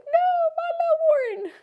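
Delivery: very high pitched mock lament; a high pitched aww of sympathy; mock wail. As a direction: cry out in a high, pitying wail for the little guy. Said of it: "and I'm like".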